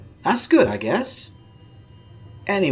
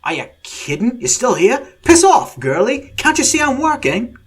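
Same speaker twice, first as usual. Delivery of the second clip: higher, louder Australian outburst; very annoyed Australian; 10 semitones higher, 5 dB louder than usual. A narrator's reading. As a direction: snap at the intruder to get lost. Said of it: "The Australian voice sounded very annoyed".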